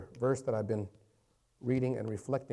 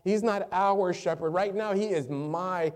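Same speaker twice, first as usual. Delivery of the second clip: raised, emphatic proclamation; impassioned preaching; 5 semitones higher, 5 dB louder than usual. Real, not acted. Preacher proclaiming the Lord as shepherd, impassioned preaching. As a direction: declare it with forceful conviction.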